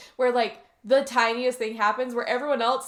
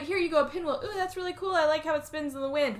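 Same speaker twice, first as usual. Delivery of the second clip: high-pitched pretend wonder at a toy; put-on childlike enthusiasm; mock delight; 7 semitones higher than usual. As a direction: act briefly thrilled, tongue in cheek. Plays child briefly delighted by toy.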